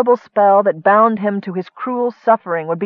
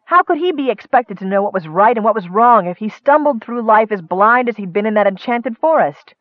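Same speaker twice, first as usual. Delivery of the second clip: plain reading voice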